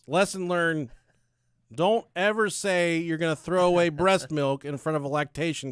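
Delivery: mock-solemn loud announcement; loud declaiming. Real, not acted. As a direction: declare the moral to the whole room. Comic storyteller's loud declaration of moral.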